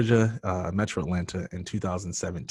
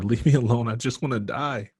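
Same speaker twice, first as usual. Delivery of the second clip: higher, loud mock scream easing off; imitated desperate yell, not full force; retold scream; 4 semitones higher, 4 dB louder than usual. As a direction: cry out to be left alone. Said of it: "I just kept screaming at her".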